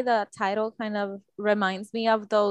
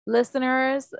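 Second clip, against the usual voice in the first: ordinary talk, nothing marked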